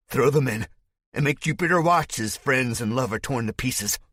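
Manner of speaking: high loud command; gleeful order. Acted. Spike commanding his soldiers, high and loud. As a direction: bellow it with cruel glee.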